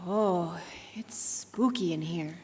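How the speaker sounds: slow, low, hushed whisper; scared whisper. Acted. Margot in the dark opera house, a slow frightened whisper. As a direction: breathe it out, afraid of the dark.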